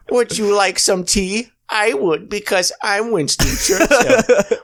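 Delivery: high, slow cartoon dad voice; a bad Peter Griffin impression; terrible Peter voice. Doing a terrible Peter to his cohost, hammed-up. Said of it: "I'm doing a terrible Peter right now".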